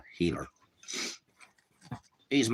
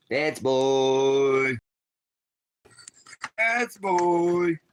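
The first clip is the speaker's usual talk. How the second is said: high, loud praise; dog praise voice; 9 semitones higher, 7 dB louder, much slower than usual. Real, not acted. Man praises his dog in a high loud voice.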